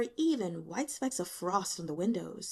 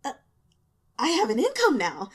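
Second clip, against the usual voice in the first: eager stammer, louder and higher; 5 semitones higher, 8 dB louder than usual. A narrator's reading. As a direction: blurt it out, eyes bright. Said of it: "he stammered".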